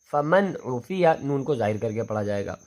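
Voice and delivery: ordinary talk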